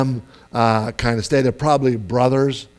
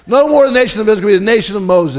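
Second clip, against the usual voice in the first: high, loud, fed-up God ranting; God paraphrased; 8 semitones higher, 7 dB louder, much faster than usual. Retold or imitated. God ranting to Moses about the people, exasperated. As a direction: fume about the useless people. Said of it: "So I read it".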